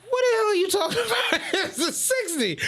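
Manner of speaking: high, fast disbelief breaking into a laugh; mock outrage, laughing at him; incredulous. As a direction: scoff at the idea through laughter. Retold disbelief at coworker.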